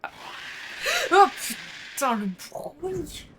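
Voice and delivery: startled, slow drawn-out curse; scared horror game streamer. Real, not acted. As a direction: jump at the sudden noise.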